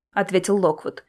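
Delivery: plain reading voice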